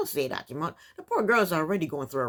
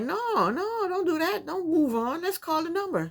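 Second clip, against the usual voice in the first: higher, eager pushing friend; excited friend; 8 semitones higher than usual. Retold or imitated. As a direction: urge her on, pitched up and eager. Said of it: "But the friend's like".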